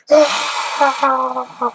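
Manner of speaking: high, loud imitated yelp of an old man; mock scream. Imitating an elderly man jumping on the pallet, high mock yelp. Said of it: "He was screaming".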